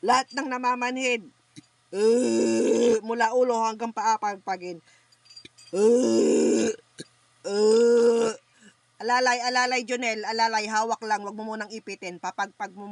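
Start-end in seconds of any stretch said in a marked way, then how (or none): 1.9-3.0 loud groaning grunts. Real, not acted. Healer grunting loudly over the patient in trance. 5.7-8.3 loud groaning grunts. Real, not acted. Healer grunting loudly over the patient in trance.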